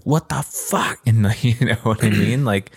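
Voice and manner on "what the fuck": shocked outburst. Retold or imitated. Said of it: "be like".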